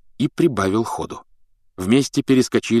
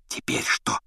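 soft voiceless whisper; 7 dB quieter than usual. A narrator's reading. Harry asking what comes next, whispered. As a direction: whisper the question cautiously. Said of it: "прошептал Гарри".

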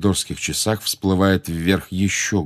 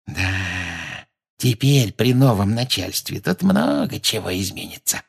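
put-on vindictive old grievance, relished; nasty gloating caretaker. A narrator's reading. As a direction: gloat over punishments to come as the caretaker. (злорадный смотритель, мстительно)

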